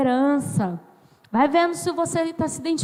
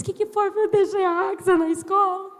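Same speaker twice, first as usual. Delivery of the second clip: high-pitched mock-tearful wail; 模仿哭诉; 6 semitones higher than usual. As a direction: act out crying to coworkers, pitched high. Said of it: "Cheguei no escritório chorando".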